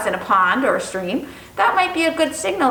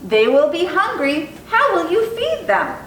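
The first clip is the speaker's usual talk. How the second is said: higher, doubtful mom asking; mother's question rising high; 6 semitones higher than usual. Acted. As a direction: pitch up as the worried mother.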